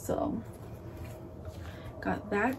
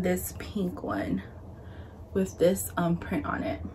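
whispering; 2 semitones lower, 7 dB quieter than usual. Real, not acted. Whispered description of swaddle.

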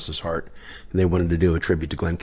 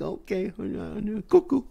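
small, high falsetto; comic high-pitched fluster; flustered squeak; 6 dB quieter than usual. Retold or imitated. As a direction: squeak along nervously to humor him. Flustered aside, acted out.

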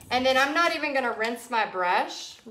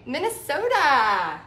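put-on long, drawn-out Minnesota accent; 3 semitones higher, 4 dB louder, slower than usual. Painter teasing a viewer from Minnesota, exaggerated Minnesota accent. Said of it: "I love y'all's accent".

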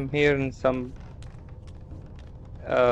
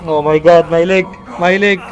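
pained yelling; 5 semitones higher, 6 dB louder, faster than usual. Real, not acted. Hurt leg, loud complaint.

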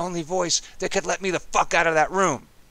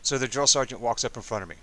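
ordinary delivery

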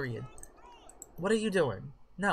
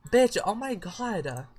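usual speaking voice

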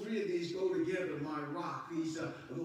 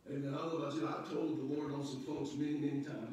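ordinary talk, nothing marked

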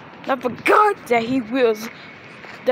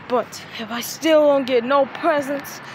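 ordinary talk

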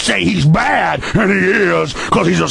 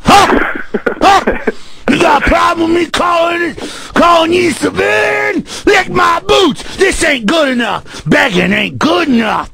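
raging cop; 5 semitones higher than usual. Retold or imitated. Host imitating a cop demanding a citizen lick his boots, raging. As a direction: scream the orders down. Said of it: "that's an imitation of 'em".

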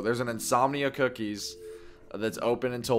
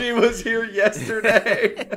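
slow words broken by laughter; laughing while repeating it; 9 semitones higher, 5 dB louder, slower than usual. Real, not acted. Laughing at the story.

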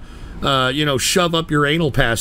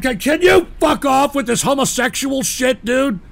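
exasperated shout; 9 semitones higher than usual. Real, not acted. Host snapping at caller.

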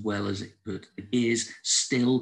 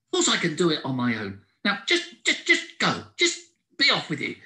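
higher, louder, offended squirrel; cross squirrel; 6 semitones higher, 3 dB louder than usual. A narrator's reading. Squirrel snapping at Howard for offering help, cross. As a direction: snap back at being offered help.

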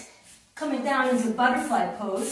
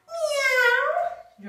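much higher, louder meow; cat meow; 12 semitones higher, 4 dB louder than usual. Pretend. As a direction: meow like a playful kitten. Cat-cow pose.

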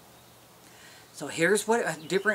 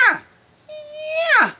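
cow call sound; 10 dB louder than usual. Shown as a demonstration. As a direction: voice a gentle elk cow call. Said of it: "for a cow sound".

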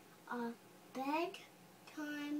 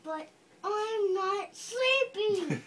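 put-on louder whiny protest from the kid in the book; passionate kid's part; 5 semitones higher, 9 dB louder than usual. Child reading the kid's bedtime protest, loud and passionate. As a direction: complain you're not sleepy, full of feeling. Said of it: "you read with passion with the kids' part".

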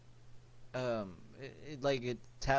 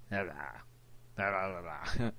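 low post-puberty voice; deep voice; 3 semitones lower than usual. Retold or imitated. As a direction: drop into a deep grown voice. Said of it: "now it's like".

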